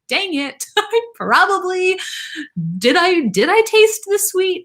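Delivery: fret, a little laughing, over whether you tasted it; panicked thought. Retold or imitated. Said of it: "that's all I was thinking was".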